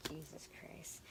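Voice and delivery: whispered exasperation; breathy whispered oath. Real, not acted. Reaction to hope card.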